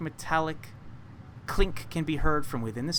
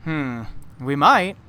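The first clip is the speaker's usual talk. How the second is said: slow, louder musing reply; a lightly voiced thoughtful man; distant man answering the girl, slow and louder; man's voice; 10 dB louder, slower than usual. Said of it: "you hear a man's voice".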